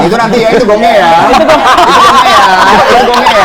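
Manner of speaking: loud, high hype announcement; excited build-up. Acted. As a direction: hype it up like a host. Building up to the punchline.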